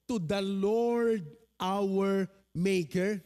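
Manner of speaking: slow, solemn reading of the verse; measured Bible reading voice; scripture reading. Acted. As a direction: read it out like holy words, unhurried. Quotes the verse.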